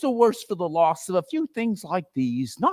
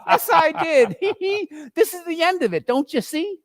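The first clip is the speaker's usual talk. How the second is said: high, cackling crone gloats over the loot; gloating old charwoman. Acted. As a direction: relish every stolen thing.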